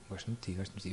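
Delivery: lovers' murmur. Retold or imitated. Couples repeating love to each other, softly murmured. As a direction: murmur it like a sweetheart. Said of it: "Como os namorados".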